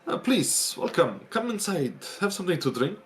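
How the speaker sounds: stiff, formal butler with a French touch; upright steward. Acted. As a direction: greet guests like a proud manor steward. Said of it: "comes out really upright and says".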